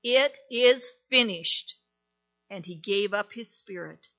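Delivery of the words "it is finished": slow, louder final cry. A narrator's reading. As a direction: call it out slowly with full voice. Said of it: "Jesus cried with a loud voice".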